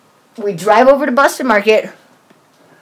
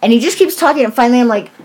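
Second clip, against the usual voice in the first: ordinary delivery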